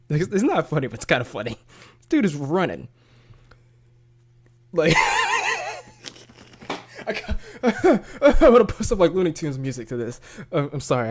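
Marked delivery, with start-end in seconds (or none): 4.7-10.2 talking through laughter; high, loud start giggled out, then settling; real laughing. Real, not acted. YouTuber tickled by chase footage, laughing as he talks. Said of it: "I shouldn't be laughing about this".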